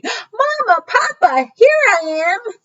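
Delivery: high, bright cry of relief; joyful cry. A narrator's reading. Pip spotting Mama and Papa, crying out high with joy. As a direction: cry out to found parents. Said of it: "cried Pip".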